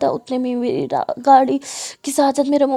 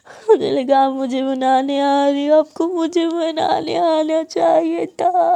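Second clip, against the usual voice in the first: slow, drawn-out crying complaint; tearful wail; 3 semitones higher, 4 dB louder, slower than usual. A narrator's reading. Hurt girl who expected to be consoled, tearful wailing. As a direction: wail it out, stretching the last words.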